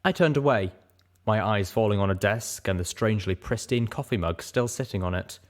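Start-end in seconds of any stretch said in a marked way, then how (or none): none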